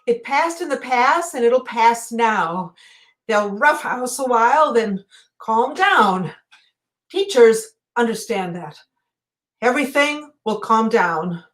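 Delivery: soft, halting, comforting murmur; calm them quietly, with pauses between phrases; soothing reassurance. Acted. Telling someone it's all right and will pass, soft reassurance.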